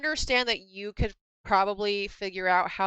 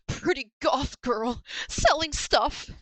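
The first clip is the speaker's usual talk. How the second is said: slow, higher, dreamy mock voice; smitten customer; 5 semitones higher, slower than usual. Playing a customer charmed by the goth seller, slow and dreamy. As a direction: drawl it like a dazed admirer.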